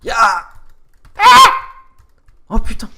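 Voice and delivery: panicking gamer. Real, not acted. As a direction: yell in sudden fright. Near fall into lava.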